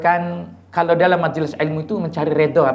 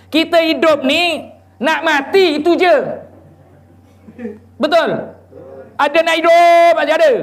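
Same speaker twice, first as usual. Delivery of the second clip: raised, higher preaching voice; fervent preaching; 8 semitones higher, 3 dB louder than usual. Acted. Preacher pressing the audience about death, fervent preaching. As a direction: preach it out to the congregation. Preacher rising to full force.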